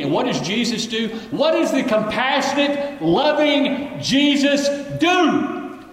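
high, loud, slow rhetorical question; rhetorical build. Acted. Preacher asking congregation what Jesus did, high and slow. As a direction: stretch out the question for effect.

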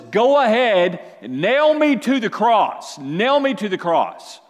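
loud and slow, pushing every word out; loud defiant offer. Acted. Preacher voicing Jesus to the congregation, loud and slow. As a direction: hold nothing back, give yourself up.

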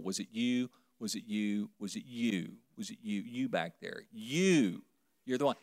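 quietly single out each face; searching quietly. Acted. Jesus searching faces one by one.

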